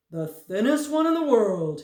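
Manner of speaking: loud, high, cheery; bright reply. A narrator's reading. Fat man answers Tock, bright and loud. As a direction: answer brightly, pleased with yourself. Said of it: "replied brightly".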